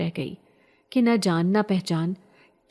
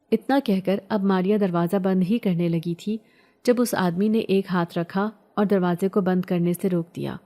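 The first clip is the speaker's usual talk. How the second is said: plain reading voice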